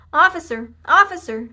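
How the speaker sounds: high, loud urgent call; shouting. A narrator's reading. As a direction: shout across the yard for the officer. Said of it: "shouted Baby".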